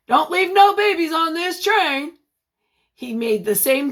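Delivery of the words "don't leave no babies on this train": conductor's joke. Acted.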